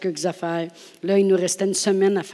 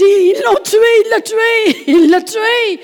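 much higher, louder repeated cry with a laugh; panicked shout; 12 dB louder, slower than usual. Acted. The crowd screaming that Jesus killed the boy. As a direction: shout it in frantic alarm.

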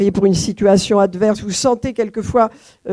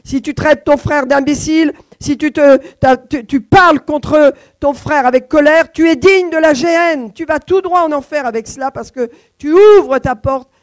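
raised, loud preaching voice, higher pitch; forceful preaching; 5 semitones higher, 4 dB louder than usual. Acted. Preacher warning the church about angry words, forceful preaching. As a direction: thunder the warning at the congregation. Preacher warns against anger.

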